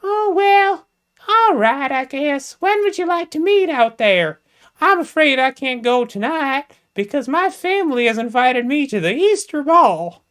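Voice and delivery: put-on high, prim falsetto, like a coy young lady. Jamesina speaking to James, in a high falsetto lady voice. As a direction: play a coy Southern belle up high.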